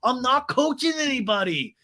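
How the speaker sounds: high mock denial; Sandoval. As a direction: imitate a defensive man protesting. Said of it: "And Sandoval's like".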